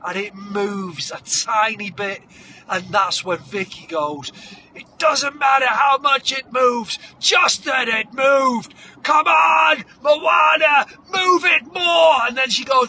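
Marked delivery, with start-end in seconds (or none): 4.8-12.3 loud, high, drawn-out trainer's yell; hammed-up montage coach urging; rousing shout. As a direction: build into a roaring pep talk.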